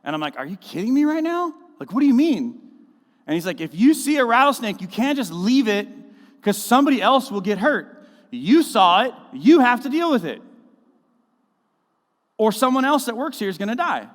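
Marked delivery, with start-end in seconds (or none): 0.4-2.6 retold disbelief, pitched far higher and louder; mock disbelief. Retelling his shocked reply to boss. 3.6-14.1 retold urgent boss, much louder and higher; hammed-up yell of a snake-hunting boss; imitated boss yelling. Voicing boss's urgent snake warning.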